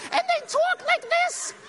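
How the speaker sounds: slow, very high squeaky falsetto; comic high-pitched impersonation; Mike Tyson. Retold or imitated. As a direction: stretch it out in a squeaky mock voice. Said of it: "he talks in this squeaky voice".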